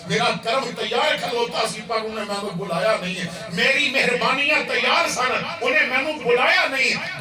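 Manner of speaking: rising loud, high lament of mercy refused; building sermon cry. Acted. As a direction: build it phrase by phrase to a peak. God's lament, building in force. (رب دی رحمت دا بلند، ودھدا ہویا پکار)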